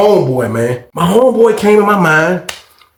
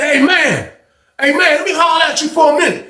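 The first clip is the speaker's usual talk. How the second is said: scared gazelle; 12 semitones higher than usual. Retold or imitated. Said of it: "like a scared gazelle".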